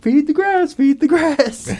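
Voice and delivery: high, airy mumbling chant; high sleepwalker voice. Retold or imitated. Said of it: "just like".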